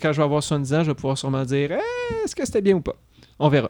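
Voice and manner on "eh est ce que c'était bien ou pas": put-on very old man voice. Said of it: "sûrement avec cette voix-là".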